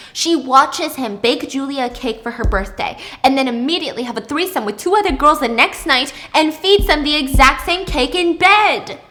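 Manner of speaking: ordinary talk, nothing marked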